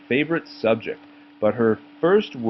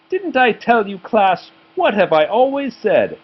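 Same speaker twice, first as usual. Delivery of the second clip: high, louder gloating teacher voice; 9 semitones higher, 5 dB louder than usual. A narrator's reading. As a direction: lord it over the class.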